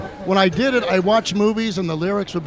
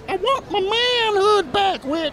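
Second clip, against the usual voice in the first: high, slow, warped voice; like a tape played back real slow; slowed-down recording; 13 semitones higher, slower than usual. Cat's slowed recording, high drawn-out warped voice. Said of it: "played it back real slow".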